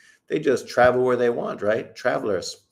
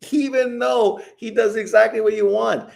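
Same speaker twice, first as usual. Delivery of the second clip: much higher, indignant; exasperated; 11 semitones higher, 3 dB louder than usual. Real, not acted. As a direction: throw up your hands at the haters. Exasperated about haters.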